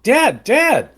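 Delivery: put-on urgent kid calling. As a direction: tug at dad with your voice. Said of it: "And I keep going like".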